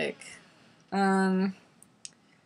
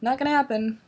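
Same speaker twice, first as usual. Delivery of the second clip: retold brush-off; 7 semitones higher than usual. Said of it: "and I'm like".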